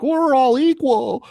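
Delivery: high, slow sarcastic squeak; mocking equality line. Mocking the idea of equal men, high and slow. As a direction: drag it out in a high mock voice. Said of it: "There's none of this, like".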